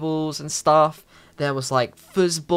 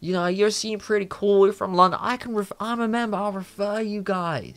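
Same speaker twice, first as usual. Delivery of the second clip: stoned guy; 5 semitones higher than usual. Retold or imitated. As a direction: play the dazed stoner doorman offering to help. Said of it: "And he was like".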